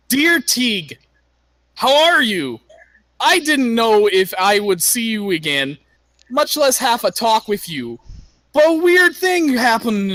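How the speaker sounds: put-on high, loud letter read in a girl's voice; Brunhilde's voice. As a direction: read it as the barbarian girl. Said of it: "I should probably do it in her character voice".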